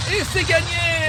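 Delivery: high, slow, triumphant cry; excited announcement. Acted. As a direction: call out the win with delight. Announcing the prize win.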